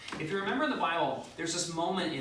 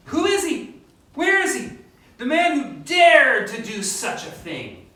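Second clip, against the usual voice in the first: riled up king; 9 semitones higher, 12 dB louder than usual. Retold or imitated. Xerxes furious, demanding to find the guilty man. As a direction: roar it like an outraged king. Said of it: "he's riled up".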